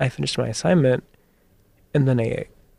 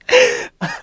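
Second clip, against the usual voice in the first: high breathless laugh. Real, not acted.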